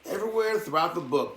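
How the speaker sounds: plain reading voice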